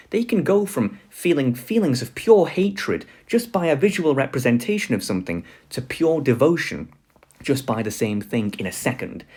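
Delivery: ordinary delivery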